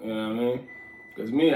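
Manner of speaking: normal talking voice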